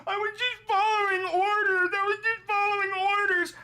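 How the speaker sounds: high, loud fake crying; mock sobbing. Mocking a jailed sailor with loud fake sobbing. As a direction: whimper it like a blubbering sailor. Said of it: "I'm gonna cheer when you're sobbing".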